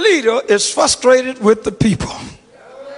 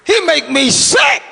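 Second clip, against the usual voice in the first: exasperated parent; 5 semitones higher, 4 dB louder, slower than usual. Retold or imitated. Parent complaining about hard-headed child.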